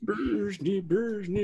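high mock Swedish chef babble; Swedish chef gibberish. Friends riffing on the Swedish chef, high mock babble.

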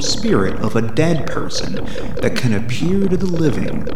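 slow, low narrator read; documentary narration. Acted. Reads encyclopedia intro over music.

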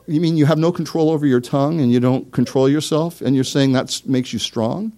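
ordinary delivery

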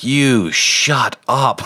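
slow, drawn-out, joking hush; mock shut up. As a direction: drag it out as a playful put-down. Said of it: "And I'm like".

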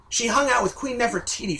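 ordinary delivery

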